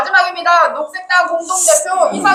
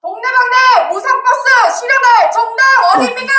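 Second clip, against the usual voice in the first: rally call; 9 semitones higher than usual. Real, not acted. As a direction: call to the crowd.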